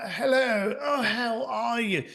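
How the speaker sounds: lightly imitated posh British greeting; a hint of a plummy British accent, drawn out. Palm Beach rich folks putting on a posh British accent. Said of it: "there was this accent".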